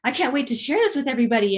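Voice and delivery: can't contain the thrill; excited. Pretend. Telling everyone about the promotion, excited. Imagined joyful promotion reaction.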